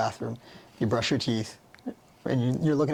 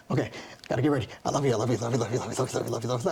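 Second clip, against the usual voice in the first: rattled off fast in a hurry; rushed; much faster than usual. Retold or imitated. Late for the day, rushing her mirror affirmations fast. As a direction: race through it like she's late. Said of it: "Sometimes I'm like".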